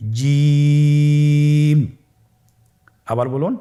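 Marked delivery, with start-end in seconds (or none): 0.1-2.0 জিম held very long, louder; long loud jim. Shown as a demonstration. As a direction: sing out জিম slowly and loud. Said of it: "আপনারা আমার সাথে বলুন". (জিম জোরে লম্বা টেনে)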